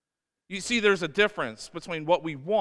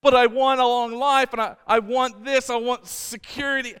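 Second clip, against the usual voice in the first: higher, louder petulant pleading; whiny wanting; 6 semitones higher, 4 dB louder than usual. Retold or imitated. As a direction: whine for what you want. Voicing selfish wanting.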